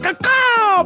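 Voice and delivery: high crow caw-caw call; chirping bird person. Pretend. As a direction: squawk like a crow.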